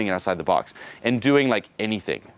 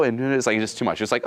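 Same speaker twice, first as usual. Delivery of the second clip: ordinary talk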